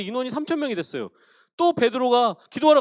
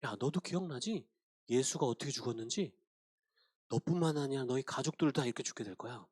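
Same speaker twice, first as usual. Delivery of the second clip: lower, much softer sinister murmur; devil whispering threats; 9 semitones lower, 11 dB quieter than usual. Acted. Devil whispering to church members, low hushed menace. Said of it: "마귀가 이렇게 속삭이는 거예요".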